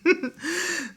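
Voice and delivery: high real laugh. Real, not acted. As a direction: burst out laughing. Laughing at the retold scene.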